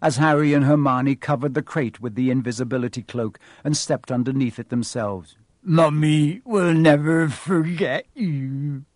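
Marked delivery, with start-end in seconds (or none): 5.6-8.8 very slow, a touch louder, tearful; tearful farewell. Acted. Hagrid bidding baby dragon goodbye, very slow and tearful. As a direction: drag out the farewell as if choking up.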